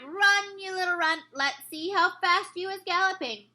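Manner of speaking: bully him out of there; giants shouting. A narrator's reading. Giants kicking the BFG, shouting jeers. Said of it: "gave him a few kicks and shouted".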